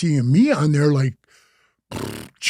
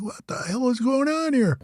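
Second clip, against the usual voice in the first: high, hushed puzzled exclamation; mock bewilderment; 10 semitones higher, 7 dB quieter than usual. Reacting to the actor's changed way of talking, mock bewilderment. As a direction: act out baffled disbelief, softly and high.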